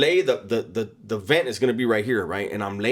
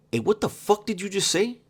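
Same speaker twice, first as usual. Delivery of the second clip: angry retort; 10 semitones higher than usual. Retold or imitated. Homie angry at insult.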